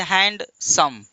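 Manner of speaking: "Handsome" slowly broken as hand, then सम; two-syllable split. Shown as a demonstration. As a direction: say "handsome" in two beats with a gap. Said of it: "दो शब्द दो बार में बोलते हैं". ("Handsome" को दो टुकड़ों में: हैंड... सम)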